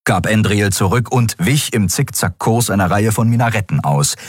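ordinary delivery, nothing marked

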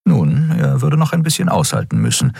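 usual speaking voice

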